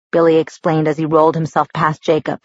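usual speaking voice

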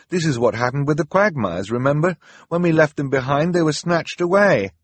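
put-on high anxious boy's voice; worried Klaus. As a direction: argue his fear to his sister. Klaus voice held.

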